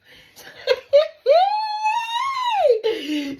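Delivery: very loud, piercing squeal; long high squeal. Retold or imitated. Storyteller celebrating after "you're good", long high squeal.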